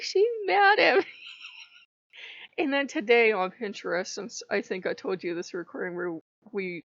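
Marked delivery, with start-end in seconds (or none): 0.0-1.0 higher, loud whiny sob; playfully imitated crying god; mock whimper. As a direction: whimper it like a wounded child. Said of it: "he was just like".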